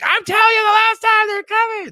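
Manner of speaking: very high, loud cartoon tirade; exasperated yell. Kenny warning Cartman one last time, high exasperated yell. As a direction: squawk it at wit's end.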